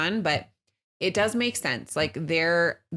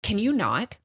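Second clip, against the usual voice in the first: high, exasperated plea; fed-up mom snapping back; a touch of exasperation; 5 semitones higher than usual. Retold or imitated. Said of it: "And I'm like".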